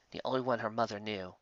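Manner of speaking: ordinary delivery, nothing marked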